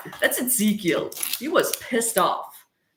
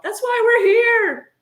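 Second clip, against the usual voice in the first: much higher, louder punchline; high exclamation; 7 dB louder than usual. Real, not acted. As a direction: sing out the punchline.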